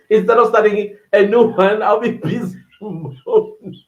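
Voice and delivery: giggling through the words; laughing while reading. Real, not acted.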